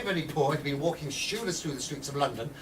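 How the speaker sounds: normal talking voice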